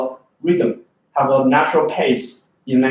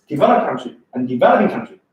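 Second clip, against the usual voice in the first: "developed" and "developing" highlighted, "country" played down; contrastive stress; 3 semitones lower, faster than usual. Shown as a demonstration. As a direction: punch "developed" and "developing" against each other. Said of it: "That's how highlight and stress work".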